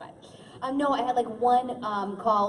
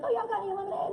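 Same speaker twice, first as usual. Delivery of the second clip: a hint of a Southern belle asking for lemonade; Southern accent; 9 semitones higher, faster than usual. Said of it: "Oh, you're from the South?".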